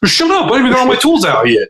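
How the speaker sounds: high-pitched mock protest; indignant retort. Griping back at the sister's teasing, high mock indignation. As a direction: snap back in a high, exasperated voice. Acted retort to being needled.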